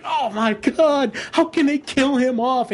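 high, indignant protest; retold outrage. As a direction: act out a fan's upset disbelief. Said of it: "I was pissed".

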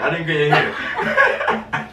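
stretched slow, laughing tease; slow teasing laugh. Real, not acted. Boyfriend teases girlfriend after prank, slow laughing drawl. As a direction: drag it out to rub it in.

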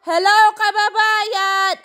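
high, slow call across the park; called-out greeting. Retold or imitated. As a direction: call out to a fellow Filipino from afar. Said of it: "nagsigaw ako". (malakas na pagtawag)

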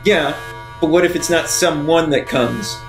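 normal talking voice

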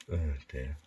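ordinary delivery

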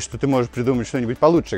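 ordinary talk, nothing marked

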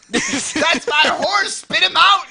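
high, full-voiced mock shouting; outraged yell. Woman shouting at the dragon that has her horse, outraged. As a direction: yell it high and indignant, hamming it up.

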